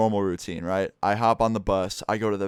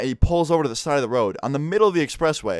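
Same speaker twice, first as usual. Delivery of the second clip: ordinary delivery, nothing marked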